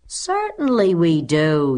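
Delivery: slow, loud, drawn-out protest; class yell. A narrator's reading. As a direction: yell it slowly as a whole class. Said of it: "yelled one and all".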